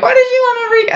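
very high, squeaky mock voice; laughing brothers' squeal. Brothers laughing at mom's odd words, high squeaky imitation. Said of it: "my brothers are laughing".

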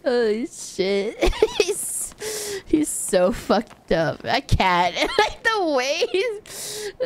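laughing hard. Real, not acted.

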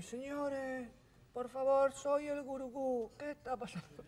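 high, slow and prim; polite guru. Retold or imitated. Restaurant owner guru asks the guests, high prim politeness. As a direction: ask it like a prissy mystic host.

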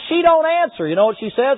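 ordinary talk

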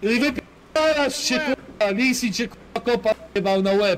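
high-pitched, swearing tirade; angry rant. Real, not acted. Player cursing a teammate who died, angry rant.

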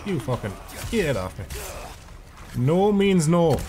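high yelled protest at the snake; startled shout. Real, not acted. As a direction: shove it off, yelling. Snake attacks him in game.

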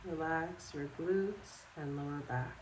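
calm, unhurried guided relaxation with long pauses; guided meditation. Acted. Yoga instructor guiding listeners to sleep in bed, calm meditation voice. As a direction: lead them through it step by step, leaving space after each instruction.

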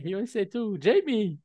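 ordinary delivery

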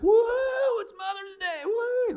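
high squealing woo, rising to a loud whoop; excited cheer. Retold or imitated. Moms cheering about Mother's Day, excited whoop. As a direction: squeal it like a thrilled mom. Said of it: "some of you were like".

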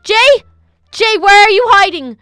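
loud, high demanding call. Acted. Kelly searching the castle for Jay, calling out loudly. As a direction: shout to flush out someone hiding.

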